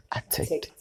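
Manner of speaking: slow, whispered secret; hushed confiding. Real, not acted. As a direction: lean in and whisper it slowly. Confiding the key point.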